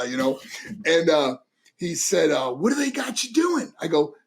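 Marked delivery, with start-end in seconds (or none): 2.5-3.7 high-pitched Brooklyn dad question; Brooklyn accent. Retold or imitated. Brooklyn father quizzing his son, Brooklyn accent. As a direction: imitate a curious Brooklyn father. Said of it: "So he talked like this".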